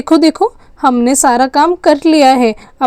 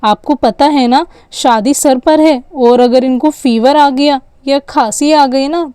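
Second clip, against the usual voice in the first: ordinary delivery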